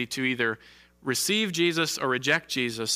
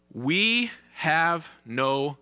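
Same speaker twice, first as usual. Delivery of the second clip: slow and heavy; much slower than usual. Retold or imitated. Chief priests' rejection read slowly.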